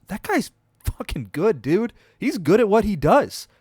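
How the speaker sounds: excited praise. Real, not acted. Host hypes a kick returner's big game, excited. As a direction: rave about him.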